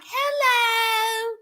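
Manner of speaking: small timid voice, drawn out; meek and timid. Retold or imitated. Mocking Bianca's timid greeting to Daniel. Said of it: "Just all meek and timid".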